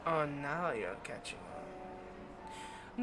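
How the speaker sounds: slow, drawn-out taunt; sly condescension. Acted. Vampire to slow-witted hunter catching on, sly condescension. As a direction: savour the dawning realisation. Vampire begins revealing the trap.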